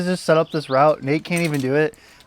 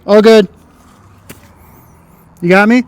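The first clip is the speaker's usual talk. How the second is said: raised voice to the belayer; calling down; 5 semitones higher, 4 dB louder than usual. Real, not acted. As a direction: call down from the wall.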